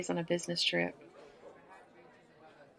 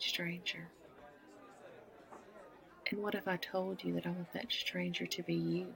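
softer and softer, sinking into a whisper; seductive murmur; 9 dB quieter than usual. Acted. An American woman seducing a stranger at the bar, sinking to a whisper. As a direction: lean close and say it just for him.

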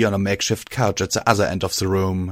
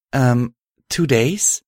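sheepish; 4 semitones higher, slower than usual. A narrator's reading. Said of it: "Violet replied sheepishly".

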